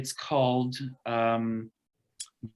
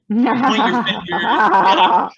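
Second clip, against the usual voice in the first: angry laughter; 9 semitones higher, 12 dB louder, slower than usual. Shown as a demonstration. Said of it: "bring out your laughter and anger all together".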